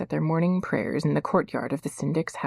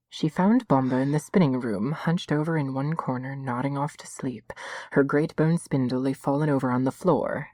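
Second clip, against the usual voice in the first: ordinary delivery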